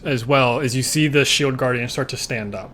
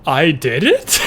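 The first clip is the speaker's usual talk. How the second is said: lightly mocked disbelief, higher and louder; higher, louder impression of a stunned friend; imitating bro; 4 semitones higher, 7 dB louder, slower than usual. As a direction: play a friend amazed at their own success. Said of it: "My bro was like".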